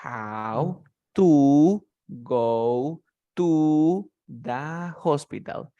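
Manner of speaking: "I don't know how to go to the hospital", each word very slow and separate. Shown as a demonstration. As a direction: read it one word at a time. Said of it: "si yo quiero leer palabra por palabra". (palabra por palabra, muy despacio)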